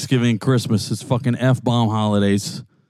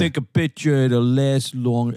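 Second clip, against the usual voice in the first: put-on Long Islandish accent. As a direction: imitate the co-host's Long Island dialect. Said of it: "See, watch".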